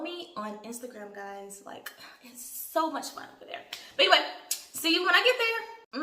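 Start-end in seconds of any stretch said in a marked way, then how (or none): 0.0-3.6 whispering. Real, not acted. Aside to viewers about Instagram.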